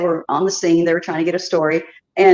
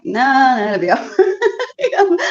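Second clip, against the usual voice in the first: laughing reassurance; 6 semitones higher, slower than usual. Retold or imitated. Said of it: "And I'm like".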